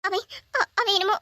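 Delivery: high, breaking into stammers; panicked fear. Acted. Girl telling her rescuer she was harmed, high and panicked. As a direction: near tears, afraid of what he did.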